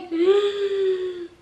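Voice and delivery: high, strained gasp of alarm; shocked gasp. Retold or imitated. Spotting the neighbor in her mailbox, shocked gasp. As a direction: gasp as if caught off guard.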